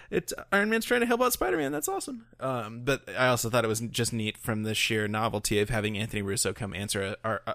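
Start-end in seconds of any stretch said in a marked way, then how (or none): 0.1-2.3 giddy, very high-pitched gushing; mock fan squeal. Fan reacting to Iron Man helping Spider-Man, giddy squeal. Fan delighted by actors' mentoring.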